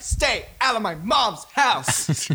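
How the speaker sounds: slow, deliberate drawn-out line; retold slowly. Player retelling Jack's slap threat, slow and deliberate. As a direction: spell it out word by word as each slap lands. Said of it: "I'm slapping him like".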